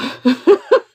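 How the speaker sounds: loud, rising giggle; high laugh. Real, not acted. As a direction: laugh out, thrilled. Laughing at her earlier reading.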